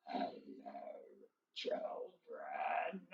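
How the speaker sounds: very low, drawn-out, strained voice. Acted. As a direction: mouse forcing her voice way down, slowly. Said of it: "a lower voice".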